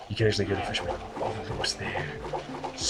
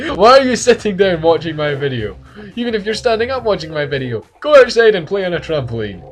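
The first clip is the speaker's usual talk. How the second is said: higher, louder sales-pitch excitement; excited ad pitch; 8 semitones higher, 8 dB louder than usual. Pretend. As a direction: sell trampolines like an ad. Mock ad pitch to viewers.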